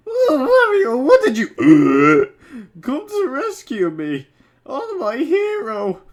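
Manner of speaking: squeaky high, hammed-up Peach; high princess voice. Comedian reading fanfic, voicing Peach in a high squeal. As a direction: gush it out like a swooning princess.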